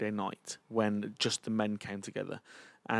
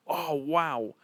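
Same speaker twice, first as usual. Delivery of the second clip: higher, drawn-out wonder; retold awe; 5 semitones higher than usual. Realising God spoke aloud, awed. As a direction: let the realisation land in wonder.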